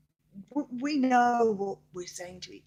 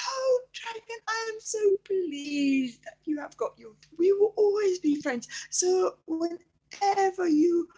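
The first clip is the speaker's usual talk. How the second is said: put-on delighted child; 7 semitones higher, 4 dB louder, slower than usual. Child delighted dragon got fire.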